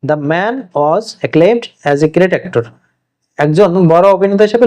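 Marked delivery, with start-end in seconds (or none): 0.1-2.6 slow dictation. Shown as a demonstration. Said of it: "example sentence".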